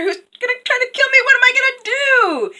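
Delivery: high, loud, hurried mock panic; rising panic. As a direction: build to a frantic high wail. Gold Tree panics.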